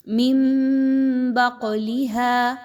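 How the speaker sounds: من بقلها with the ن turned into a nasal م, very slow; teacher models iqlab in من بقلها. Shown as a demonstration. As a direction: say من as "mim" before ب, humming. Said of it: "نون کی آواز کو میم کی آواز میں بدل".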